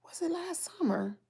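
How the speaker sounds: whispered to herself, trailing off. Real, not acted. Searching her phone, muttering under her breath in a whisper.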